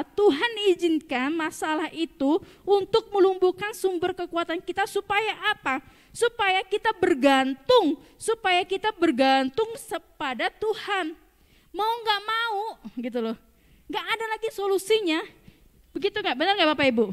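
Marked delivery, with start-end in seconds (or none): 6.1-11.1 slow, loud emphatic preaching. Acted. Preacher tells the congregation to depend on God, slow and loud. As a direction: press the point home slowly.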